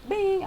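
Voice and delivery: high, short imitated "bing"; gas light ding. Gas light interrupts her chilling, high imitated ding. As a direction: chime like a dashboard alert. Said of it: "and it goes".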